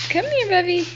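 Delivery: high, bright call to the dog. Real, not acted. Calling the dog over.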